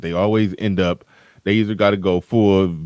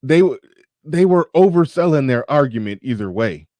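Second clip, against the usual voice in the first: ordinary delivery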